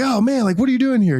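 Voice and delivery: high, surprised "oh man" greeting. Retold or imitated. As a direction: act bumping into an old buddy, pitched well up. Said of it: "I'm like".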